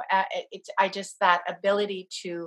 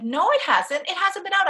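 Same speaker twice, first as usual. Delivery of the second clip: higher, urgent insistence; insistent protest; 11 semitones higher, 4 dB louder than usual. Retold or imitated. Protesting to husband the sun never came out, insistent. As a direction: push back eagerly.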